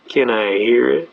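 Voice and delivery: slow, low, drawn-out prompt; expectant judge. Retold or imitated. Judge asking the waiting defendant for his reason, drawn out.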